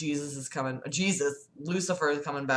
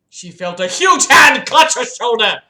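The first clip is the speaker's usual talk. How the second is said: loud, higher burst of storytelling; dramatic narration; 4 semitones higher, 11 dB louder than usual. A narrator's reading. Narrator as a hand grabs the doctor, loud and dramatic. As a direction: punch out the shock at high volume.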